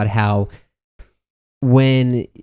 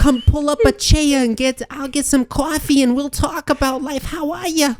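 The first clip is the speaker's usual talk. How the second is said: very high Boston grandmother voice; thick Boston accent. Retold or imitated. Grandmother inviting her grandson in, thick Boston accent. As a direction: imitate a doting grandma, broad Boston vowels. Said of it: "super thick accent".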